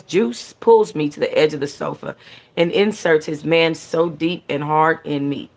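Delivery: ordinary delivery, nothing marked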